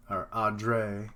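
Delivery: "Audrey" slowly stretched out; drawn out. Shown as a demonstration. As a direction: draw out the name "Audrey". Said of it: "It's weird how it's so drawn out".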